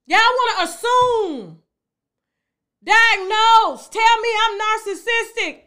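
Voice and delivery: loud, high, drawn-out complaint; indignant. Real, not acted. Answering viewers who diagnose her, loudly indignant.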